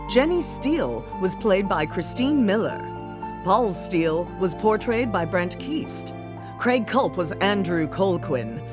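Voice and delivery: ordinary talk, nothing marked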